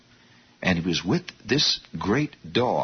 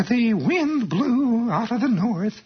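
put-on high, slow, preacherish old-lady reading voice; cracked old-lady teacher; 13 semitones higher, slower than usual. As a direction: read like a cracked old English teacher, pitched way up and drawn out. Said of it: "cracked old lady English teacher voice".

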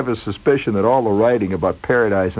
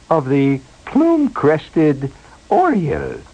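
higher, slow, prissy announcer voice; prim radio lady; 6 semitones higher, slower than usual. Retold or imitated. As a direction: mimic a prim BBC presenter, drawn out. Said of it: "the lady's voice came on".

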